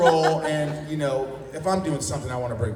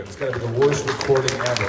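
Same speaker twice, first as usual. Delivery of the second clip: ordinary talk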